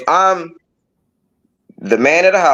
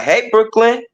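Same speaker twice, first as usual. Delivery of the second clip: "Hey, Brooklyn." called out again with a much higher, rising "Brooklyn"; 8 semitones higher, slower than usual. Retold or imitated. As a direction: call her name again, pitched up high and rising at the end. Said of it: "And I'd be like".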